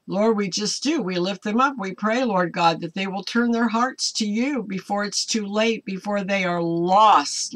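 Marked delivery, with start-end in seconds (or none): none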